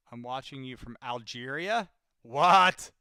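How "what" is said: surprised. Real, not acted. Surprise at viewer from Algeria.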